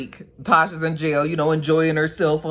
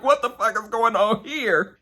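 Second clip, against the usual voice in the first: high confused question through laughter; can't believe it, laughing; laughing disbelief; 6 semitones higher than usual. Retold or imitated. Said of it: "He was like".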